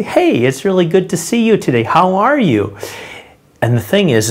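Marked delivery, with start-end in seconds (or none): 0.0-2.8 higher, put-on plastic-smile hello; fake cheerful greeting. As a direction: sound falsely delighted to see them. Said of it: "the plastic smile".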